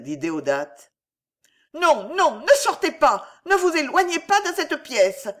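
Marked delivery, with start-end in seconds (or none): 1.8-5.3 high, loud, panicked pleading; frantic cry. A narrator's reading. Alice begs her aunt not to leave, frantic cry. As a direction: cry out high, beside yourself. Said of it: "s'écria Alice hors d'elle".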